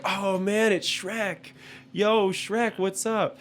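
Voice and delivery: high-pitched mock-excited drive-through worker; excited greeting. Imitating Burger King staff greeting the cutout, high and excited. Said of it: "they were like".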